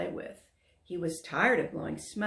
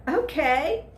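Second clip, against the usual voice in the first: loud, much higher glad shout; cried; 10 semitones higher, 7 dB louder than usual. A narrator's reading. Dragon crying yes to playing, loud and glad. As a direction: burst out happily. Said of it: "Dexter cried".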